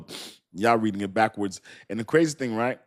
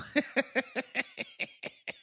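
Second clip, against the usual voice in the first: airless, high wheeze of laughter; breathy silent laugh; wheezing laugh; 13 semitones higher, 9 dB quieter than usual. Real, not acted. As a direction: laugh without voice.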